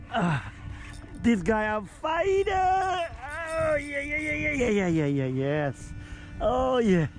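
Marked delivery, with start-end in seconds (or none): none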